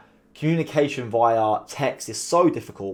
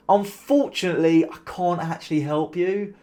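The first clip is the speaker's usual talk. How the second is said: "Unfortunately" rising and higher, slow and drawn-out; sarcastic; 5 semitones higher than usual. Shown as a demonstration. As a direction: sneer it, stretching each word with fake regret. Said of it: "same sentence twice and they can mean completely different things".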